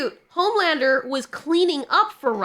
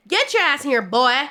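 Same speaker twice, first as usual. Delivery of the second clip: a hint of a harsh slave-owner bark, a bit louder at the end; mock-barking an order at the boy; 3 dB louder than usual. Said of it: "I said it like a slave owner".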